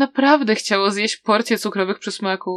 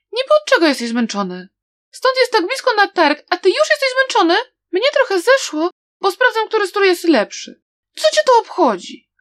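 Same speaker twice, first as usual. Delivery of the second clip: loud bickering; 11 semitones higher, 5 dB louder than usual. Acted. Girl bickers to hide fear.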